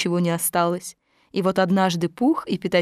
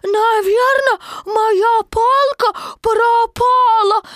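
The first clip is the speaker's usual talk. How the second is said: put-on high, loud, drawn-out child; a small joey worried his stick is lost; squeaky fretful kid; little Roo voice; 13 semitones higher, 5 dB louder, much slower than usual. A narrator's reading. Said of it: "сказал Ру".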